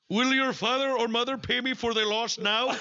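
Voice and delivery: high mock Indian accent, breaking into laughter. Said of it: "and I quote".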